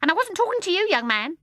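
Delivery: shrill, high, loud bird voice; indignant starling. Acted. The starling to baby John, shrill and indignant. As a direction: snub him in a shrill bird voice.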